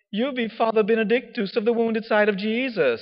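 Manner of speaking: high, grand mock-solemn title; theatrical teacher. Teacher priest bestowing a grand name, high theatrical voice. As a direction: announce it like a stage proclamation. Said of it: "he was very theatrical in the way that he spoke".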